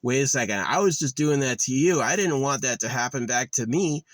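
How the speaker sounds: hammed-up panic, suddenly loud; mock startled creator. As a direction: play the creator caught off guard. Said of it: "the whole energy changes, like".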